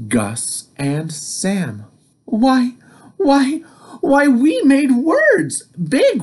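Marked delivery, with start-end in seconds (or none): 2.2-6.2 high, bright excited firefly; excited exclaiming. Acted. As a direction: squeal it out with delight.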